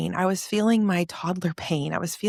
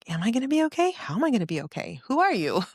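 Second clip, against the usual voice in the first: incredulous questions; 6 semitones higher, faster than usual. Retold or imitated. Retold answering a voice in disbelief.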